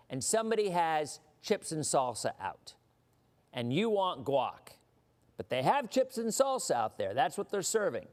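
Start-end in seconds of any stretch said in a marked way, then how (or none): none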